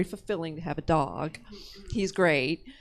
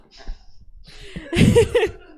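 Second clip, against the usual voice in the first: high laugh; 6 dB louder than usual. Real, not acted. Nervous laugh on stage.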